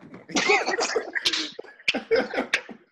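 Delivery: high-pitched burst of laughter; real laughter. Real, not acted. Co-host cracking up at the last finals pick, laughing.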